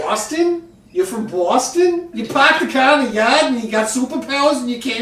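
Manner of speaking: put-on loud, fast, high Boston accent; hammed-up Boston accent. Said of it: "doing the Boston accent".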